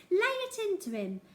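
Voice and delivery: put-on old woman shrieking at the headmaster. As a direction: egg him on at the top of her voice. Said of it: "she was shrieking".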